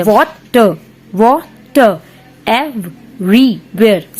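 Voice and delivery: "Water, water everywhere" slowly broken into seven separate syllables; teacher tapping out "Water, water everywhere" beat by beat; counting out syllables. Shown as a demonstration. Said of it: "seven syllables हो गए".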